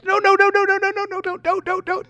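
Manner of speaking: put-on dad begging toddler not to walk down tree, panicked high plea; panicked pleading. Panicking as son climbs down.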